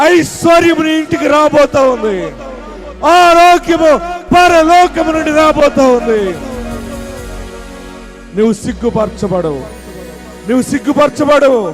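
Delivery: climactic proclamation. Real, not acted. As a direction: proclaim the blessings at full force. Sermon climax of blessings. (ఉచ్చస్థాయిలో గట్టిగా ప్రకటన)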